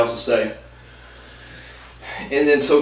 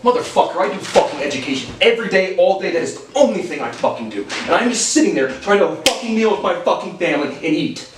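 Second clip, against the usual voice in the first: heated, higher-pitched venting, emphatic swearing; indignant rant; 4 semitones higher than usual. Real, not acted. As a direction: let the exasperation pour out. Heated rant back at uncle.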